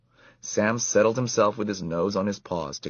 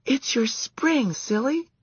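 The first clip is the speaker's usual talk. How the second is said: put-on teasing girl voice; slower than usual. A narrator's reading. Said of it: "said another girl".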